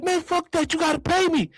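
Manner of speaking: high-pitched acted demand; indignant attitude. Crashed passenger to driver, high indignant demand. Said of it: "This my attitude at the time".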